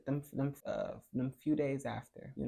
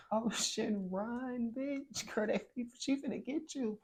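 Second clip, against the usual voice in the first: panicked warning; 10 semitones higher, slower than usual. Retold or imitated. Warning friend of angry ghost.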